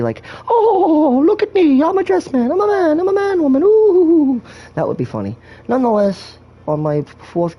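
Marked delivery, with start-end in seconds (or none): 0.5-4.4 high, loud hammed-up lady voice; mock lady voice. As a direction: camp it up like a lady. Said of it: "talk him like a lady".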